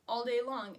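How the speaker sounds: ordinary talk, nothing marked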